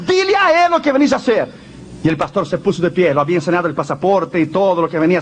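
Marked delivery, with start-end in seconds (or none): 0.1-1.6 final command. Acted. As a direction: say it like the very last time. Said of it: "por la última vez".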